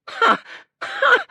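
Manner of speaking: two loud, very high bursts; hiccuping laugh. A narrator's reading. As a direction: laugh in hiccups, angry and scared. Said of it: "His laugh came out one burst".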